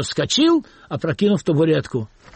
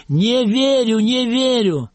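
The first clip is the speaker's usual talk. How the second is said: higher mock director's verdict; imitating Stanislavsky; 7 semitones higher, slower than usual. Khuriev quotes Stanislavsky to the actors, mock director's verdict. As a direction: pronounce it like the great director. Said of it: "Станиславский прерывал репетицию и говорил".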